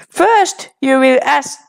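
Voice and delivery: ordinary talk, nothing marked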